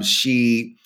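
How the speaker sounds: ordinary talk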